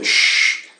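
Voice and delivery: "sh" as a long unvoiced hiss; hushing sh. Shown as a demonstration. Teacher demonstrating the hissing sound, hushing sh. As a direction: let "sh" hiss out like a hush. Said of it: "o chiado aqui é".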